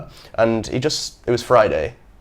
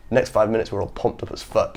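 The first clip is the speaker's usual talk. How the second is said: usual speaking voice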